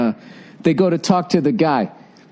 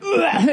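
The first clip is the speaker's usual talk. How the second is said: high retching "ugh"; mock gagging; 14 semitones higher than usual. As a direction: heave as if about to puke. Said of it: "Gordon was gagging".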